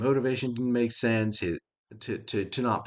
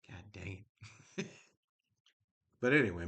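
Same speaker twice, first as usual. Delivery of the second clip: ordinary talk, nothing marked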